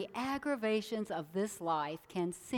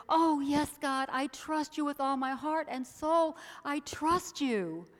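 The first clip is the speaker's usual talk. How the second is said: eager assurance; 6 semitones higher, 3 dB louder than usual. Acted. Man assures God.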